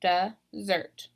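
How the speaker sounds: desSERT, broken into two, second syllable stressed; weight on the end of "Dessert". Shown as a demonstration. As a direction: sound out "Dessert" as duh, ZERT. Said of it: "I'm stressing that second syllable".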